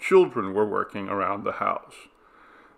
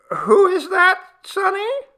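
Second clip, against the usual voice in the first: put-on very high thin old voice; granny voice; 10 dB louder than usual. A narrator's reading. Grandmother asking who the man is. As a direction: ask like a frail old grandmother.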